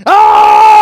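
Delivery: sudden high, loud anguished cry; a wild frustrated howl; frustrated scream. Acted. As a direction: let out a desperate yell of despair. Samson in the cave, frustrated.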